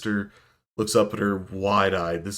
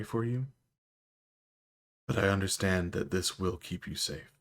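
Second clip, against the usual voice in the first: solemn goddess; 6 dB quieter than usual. Acted. Goddess blesses the child.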